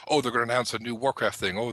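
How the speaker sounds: ordinary talk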